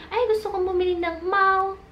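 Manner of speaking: mock wrong tone. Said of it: "sinabi nyo sa tindera".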